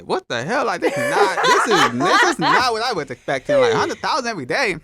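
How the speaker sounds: loud, high-pitched disbelief with laughter; incredulous retelling. Retold or imitated. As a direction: exclaim it high and loud, playing up the amazement. Retelling disbelief at follower growth.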